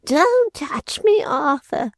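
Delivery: high, slow, pleading maid's voice; frightened cry. A narrator's reading. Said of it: "she cried, shrinking from him".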